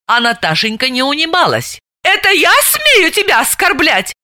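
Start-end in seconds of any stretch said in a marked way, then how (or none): 2.0-4.1 high, yelled retort; вопила; yelling. A narrator's reading. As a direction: howl it back at her. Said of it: "вопила она".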